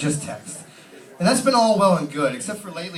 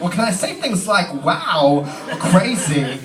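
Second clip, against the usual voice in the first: Christopher Walken voice; 2 semitones higher, 4 dB louder, much slower than usual. Pretend. Walken quoted replying to agent.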